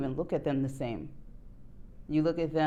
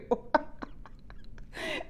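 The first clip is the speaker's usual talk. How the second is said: breathless high giggle; high laugh; 6 dB quieter than usual. Real, not acted. Reader reacting to the confusing energy, high laugh. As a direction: burst into a high, airy laugh.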